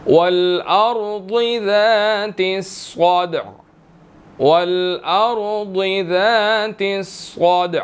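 drawn-out melodic verse; slow Quran recitation. Shown as a demonstration. Teacher recites the next verse, slow melodic tilawat. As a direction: chant it slowly and long.

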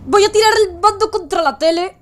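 losing it at the game; frustrated outburst. Real, not acted. Gamer caught again, exasperated rising outburst at the screen.

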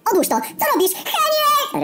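high panicked screaming; głośny krzyk. Acted. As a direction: shriek it out in panic. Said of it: "głośny krzyk ze środka magazynu". (przerażony, piskliwy krzyk)

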